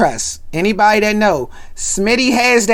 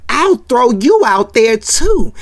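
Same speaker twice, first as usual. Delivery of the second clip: mock threat; 5 semitones higher, 5 dB louder, slower than usual. Theo threatening.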